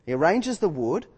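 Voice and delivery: ordinary delivery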